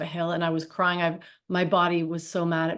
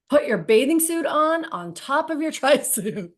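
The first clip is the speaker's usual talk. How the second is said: coach's order; 7 semitones higher, 4 dB louder than usual. Retold or imitated. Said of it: "And he's like".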